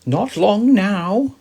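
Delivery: high, slow, loud sun character; a big friendly star speaking slowly; high and drawn-out sun voice. A narrator's reading.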